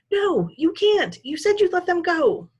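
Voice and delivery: put-on high, pleading young voice; a boy's desperate plea. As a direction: beg him not to do it. Jed pleads with his grandfather.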